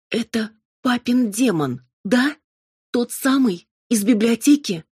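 high, loud, outraged questioning; furious accusation. A narrator's reading. Amalia pointing at the demon, furious accusation. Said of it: "яростно воскликнула она".

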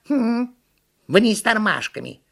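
high monkey quip; high-pitched monkey aside; monkey character. A narrator's reading. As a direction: add it pertly as a cartoon monkey. Monkey adds remark.

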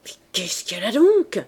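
whispered grumble through the teeth; a hissed aside to himself; grumbled under breath. Acted. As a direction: mutter it unvoiced, resentful. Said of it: "en grommelant dans ses dents".

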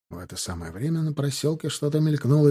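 plain reading voice

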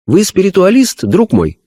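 high, earnest medium voice; a spirit medium describing a vision; seance medium. Acted. Medium asks. (голос медиума на сеансе)

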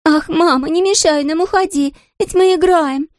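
put-on high pleading child voice; pleading little princess. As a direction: beg mother to leave, high and sweet. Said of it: "попросила она". (высокий умоляющий детский голос)

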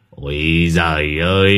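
long, drawn-out scoffing groan; dismissive exclamation. A narrator's reading. Servant waves away his master's anger, scoffing. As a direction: stretch it out at full voice, brushing him off.

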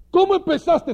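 shouted high taunt; mock accusing shout; calling out. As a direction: call out loud and high to taunt him. Acted accuser calls out.

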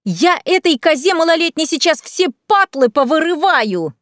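high-pitched scolding outburst; angry yelling. A narrator's reading. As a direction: build to a high, threatening yell. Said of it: "орала Лена". (гневный крик с угрозой)

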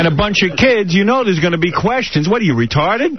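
high-pitched exasperated lecture; acted telling-off of a friend; retold scolding. Dad berating his friend at dinner, acted exasperation.

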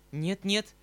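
громко выкрикнуть, почти в крик; резко перебить громким «не надо!»; вскрик. Acted. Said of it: "вскричала Настенька". (громкий испуганный вскрик)

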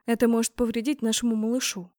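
ordinary delivery

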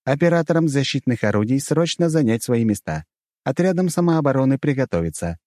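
a hint of public-address alert; loudspeaker orders. A narrator's reading. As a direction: issue defence orders over the speakers. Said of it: "раздался голос из динамиков".

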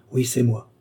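plain reading voice

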